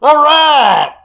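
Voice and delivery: high, loud scream of a heckler. Retold or imitated. Guy in the crowd yelling during the presentation, screaming. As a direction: yell it out like an over-eager audience member. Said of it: "literally screaming out every ten seconds".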